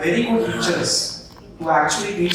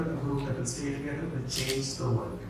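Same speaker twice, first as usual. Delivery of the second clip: quiet closing line; 4 semitones lower, 12 dB quieter than usual. Shown as a demonstration. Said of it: "let's try this one last time".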